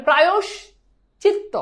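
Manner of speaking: প্রায়শ চিত্ত split in two, "prayosh... chitto"; syllable-by-syllable demo. Shown as a demonstration. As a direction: pause after প্রায়শ, then চিত্ত. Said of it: "উচ্চারণটা ভালো করে খেয়াল করো".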